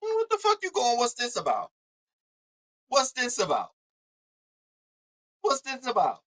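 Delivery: high, put-on puzzled voice; mock bewildered questioning. Acted confused reaction in retold exchange.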